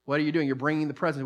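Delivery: ordinary talk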